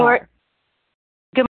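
choppy cutting-out phone line; words dropping out on a bad line; phone breaking up. Real, not acted. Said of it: "it sounds like you're breaking up a little bit there".

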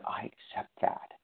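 breathy whisper of acceptance; whispered admission. Real, not acted. Admitting to the group he's a compulsive overeater, whispered. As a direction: whisper it as a confession.

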